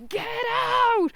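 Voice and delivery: high, sharp mock yell of the scene; mock film shout. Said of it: "where he went".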